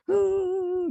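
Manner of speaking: high mock sobbing gasp; a quick hammed-up sob. Teacher mimics teens sobbing, mock sob. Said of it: "nggak mungkin nangis".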